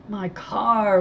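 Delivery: man watching his car burn, in dismay; anguished cry. Acted. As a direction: call it out in dismay. Man sees garage burn.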